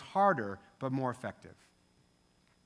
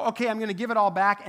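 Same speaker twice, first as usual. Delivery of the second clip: quick, louder, eager pledge, stress on all; eager promise; 4 semitones higher, 6 dB louder, faster than usual. Retold or imitated. Zacchaeus promising too early, quick and eager. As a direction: blurt the promise to repay. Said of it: "Did Zacchaeus go".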